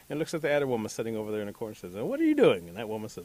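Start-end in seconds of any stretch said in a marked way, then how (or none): 1.9-2.7 high, loud inquisitive question; curious rabbit. Acted. Rabbit asks a working woman, curious and high. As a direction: pipe up, lightly acted nosy rabbit.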